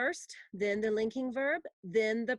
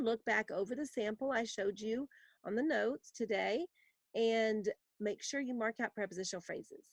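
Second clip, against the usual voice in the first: usual speaking voice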